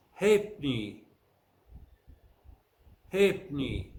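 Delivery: Halfpenny as "HAYP-nee", in two syllables; Halfpenny squeezed to "HAYP-nee", first syllable stressed; silent F. Shown as a demonstration. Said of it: "the F is silent in the following word".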